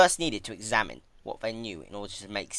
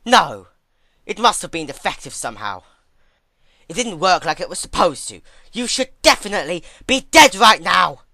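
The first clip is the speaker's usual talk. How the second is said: loud, high, furious denial; angry disbelieving shout; 6 semitones higher, 12 dB louder than usual. Acted. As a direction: yell it like you refuse to believe it. Twilight refuses to accept Celestia survived.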